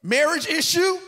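loud, high, half-sung preaching; preaching at full force. Acted. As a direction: lift the crowd with a chanted build. Preaching build-up.

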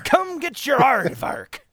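high, slow parody of a dwarf's catchphrase; hammed-up accented character call; Torbjorn. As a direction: draw it out in a mock Scandinavian accent. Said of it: "everyone can do a Torbjorn accent".

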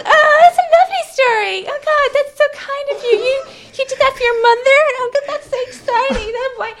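very high, loud mock cooing; hammed-up adoring squeal; gushing admiration. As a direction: coo as if hearing something adorable. Retelling gushing over the attempted murder.

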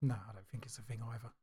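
whispered. Real, not acted. Sudden hushed reply.

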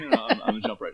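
stifled giggles. Real, not acted. Laughing while setting up joke.

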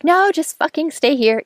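sharp high-pitched snap, telling him to stay; angry order. Retold or imitated. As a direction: make a stand, pissed off. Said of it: "I'm making a stand that I'm pissed".

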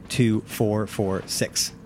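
normal talking voice

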